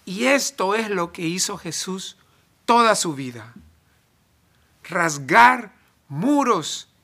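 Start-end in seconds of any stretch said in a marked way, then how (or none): none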